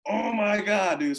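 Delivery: high-pitched retold groan of regret; exasperated regret. Storyteller realizing he totally blew it, exasperated.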